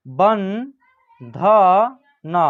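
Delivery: बंधन very slowly, syllable by syllable; slow dictation of बंधन; broken into syllables. Shown as a demonstration. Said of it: "तोड़ के पढ़िएगा".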